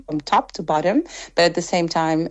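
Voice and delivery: ordinary talk, nothing marked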